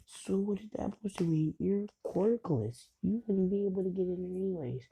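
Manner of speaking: high-pitched retold taunt; jeering classmates. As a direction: talk down to him, pitched high. Classmates taunt quirkless boy.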